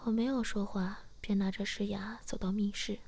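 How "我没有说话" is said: ordinary delivery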